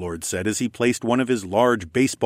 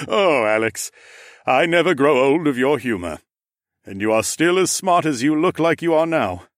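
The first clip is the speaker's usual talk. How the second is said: teasing Ram Lord; 7 semitones higher, 5 dB louder than usual. Acted. Future Gav teasing Alex.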